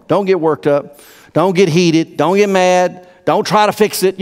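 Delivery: normal talking voice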